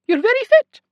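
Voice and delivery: very high, loud sing-song praise; chirpy high nurse; Scottish nurse voice. A narrator's reading. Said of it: "a heavy Scottish accent and a high-pitched sing-song voice".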